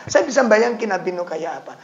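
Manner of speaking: ordinary talk, nothing marked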